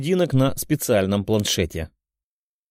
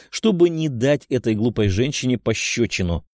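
plain reading voice

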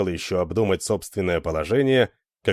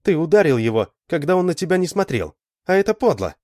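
high-pitched, indignant reproach; indignant accusation; 8 semitones higher, 4 dB louder than usual. A narrator's reading. Lita condemns Alan's sneak blow, indignant.

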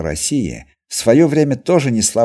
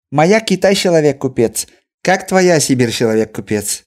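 Chinese merchant voice; 6 semitones higher, 3 dB louder than usual. A narrator's reading.

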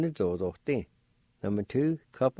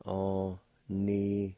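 slow demonstration; slower than usual. Shown as a demonstration. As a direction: take Anitali slowly and carefully. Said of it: "It's pronounced like this".

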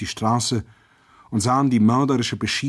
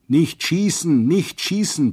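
higher, louder yell of Nicht schießen; crowd yelling; 7 semitones higher, 4 dB louder, slower than usual. A narrator's reading. Crowd shouts at the soldier aiming at civilians, desperate yell. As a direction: shout to stop the soldier firing. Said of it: "brüllten wir alle".

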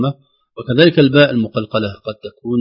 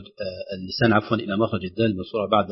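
ordinary talk

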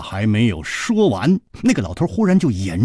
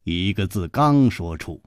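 ordinary delivery, nothing marked

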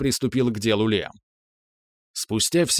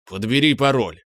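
irritated. Acted. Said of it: "с некоторым раздражением выдал Виктор".

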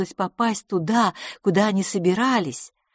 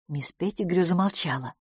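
usual speaking voice

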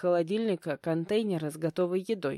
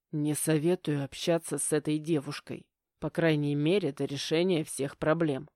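ordinary delivery, nothing marked

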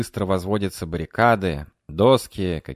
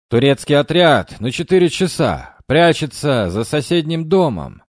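loud lookout shout; shouted warning; 6 semitones higher, 8 dB louder than usual. Acted. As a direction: yell the alarm from the roof. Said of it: "выкрикнул один из бойцов".

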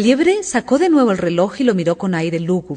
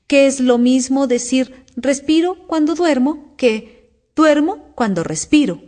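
slow, higher-pitched sleep-talking dormouse; 6 semitones higher, 3 dB louder, slower than usual. A narrator's reading. The dormouse joins in, as if talking in its sleep. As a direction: drone it slowly, as if dreaming aloud. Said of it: "parecía estar hablando en sueños".

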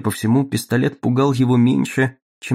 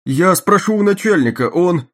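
nervous guard; 7 semitones higher, 5 dB louder than usual. Acted.

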